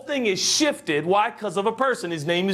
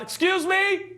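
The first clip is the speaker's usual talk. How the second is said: high call for attention; 12 semitones higher, slower than usual. Retold or imitated. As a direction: call out over a noisy crowd. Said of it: "And Jesus calls out".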